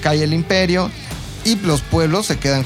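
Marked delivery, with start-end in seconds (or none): none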